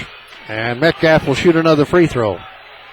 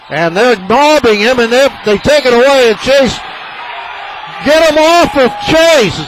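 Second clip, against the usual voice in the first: excited shout; 9 semitones higher than usual. Real, not acted. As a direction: yell it as the crowd mobs the player. Excited play-by-play, fans mobbing.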